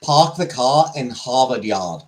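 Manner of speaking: slow Boston accent, R's dropped. Shown as a demonstration. Streamer quoting the phrase known for the Boston accent, slow Boston accent. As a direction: say it slowly like a Bostonian. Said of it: "for the Boston accent is".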